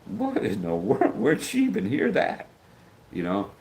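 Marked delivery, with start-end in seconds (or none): 0.1-2.5 much higher, slow, stunned disbelief; retold gasp of shock at a swear word. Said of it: "was just like".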